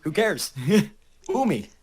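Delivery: high, loud and laughing through it; laughing mock indifference. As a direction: shrug off the heckler with a laugh. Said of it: "you take it as".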